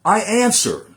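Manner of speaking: thundering nineteenth-century oratory; impassioned oration. Acted. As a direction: deliver it like a fiery speech to a hall. Recites Douglass's Fourth of July speech.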